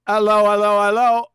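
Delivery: mock English hello, ello ello; English accent hello. Shown as a demonstration. Host mocks the English way of saying hello, English accent. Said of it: "In England, it's".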